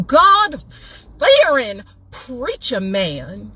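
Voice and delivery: high, hammed-up announcer voice, drawn out; mock-congratulate the other woman like a prize host; bitter mock prize announcement; sarcastic game-show reveal. Real, not acted.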